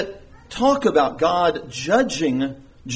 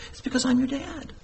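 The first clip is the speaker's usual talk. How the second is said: soft, higher-pitched gentle 'I'm your dad'; tender fatherly reason; 9 semitones higher, 6 dB quieter, faster than usual. Shown as a demonstration. Father telling children why to obey, tender and soft. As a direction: reassure softly, as a loving parent. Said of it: "But we have a relationship".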